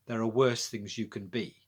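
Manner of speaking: ordinary delivery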